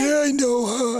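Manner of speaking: hammed-up cocky old man; proud old man. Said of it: "he was like".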